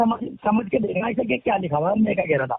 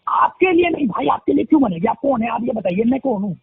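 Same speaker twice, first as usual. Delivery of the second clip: loud, indignant retort; angry shouting; 3 semitones higher, 9 dB louder than usual. Real, not acted. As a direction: shout back in anger. Heated argument, shouting back.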